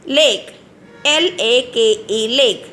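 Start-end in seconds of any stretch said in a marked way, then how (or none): none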